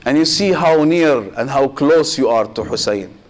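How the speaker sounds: usual speaking voice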